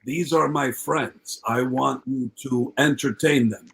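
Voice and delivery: slow, deliberate star giving orders. Retold or imitated. Rock star presenting his friends to a comic, imitated slow command. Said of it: "And he goes".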